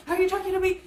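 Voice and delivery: confrontational. Retold or imitated. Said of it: "I would be like".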